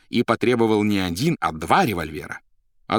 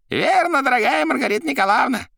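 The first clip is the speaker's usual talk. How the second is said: very high, loud character voice; excitable Koroviev; 6 dB louder than usual. A narrator's reading. Koroviev confirming his suspicions, high and excitable. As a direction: exclaim theatrically in a high voice.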